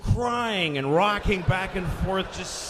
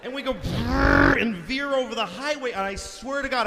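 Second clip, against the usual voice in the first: normal talking voice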